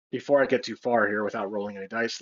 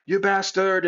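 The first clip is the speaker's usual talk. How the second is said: higher-pitched, half-hearted cry of outrage; mild mock scream; 6 semitones higher than usual. Hero screams at the villain from the roof, mild mock scream. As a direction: pretend to scream without really shouting. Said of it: "like, scream".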